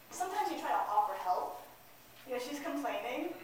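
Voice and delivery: ordinary delivery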